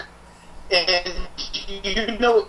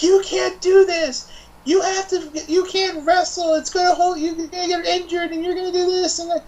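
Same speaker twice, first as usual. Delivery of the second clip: mocking Stephanie; 13 semitones higher than usual. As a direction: lecture her like a smug boss. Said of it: "Stephanie's like".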